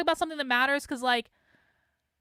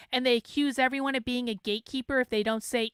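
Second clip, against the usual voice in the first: ordinary talk, nothing marked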